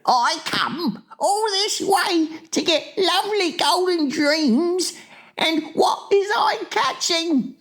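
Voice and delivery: very high, wailing complaint; distressed lament. A narrator's reading. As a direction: bemoan rotten luck at full pitch. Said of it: "getting more distressed".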